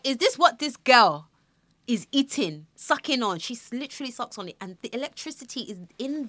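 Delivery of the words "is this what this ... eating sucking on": high, loud shocked question; stunned to feel the shock; shocked disbelief. Retold or imitated. Mother stunned after tasting the charger, shocked high question.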